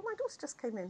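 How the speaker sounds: ordinary talk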